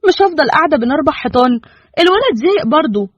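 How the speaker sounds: a wife pleading to go out, mildly sulky; voiced young wife. Wife begging her husband to let her out, mildly sulky.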